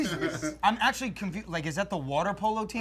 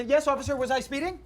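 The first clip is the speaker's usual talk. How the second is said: polite to cop; 6 semitones higher than usual. Retold or imitated.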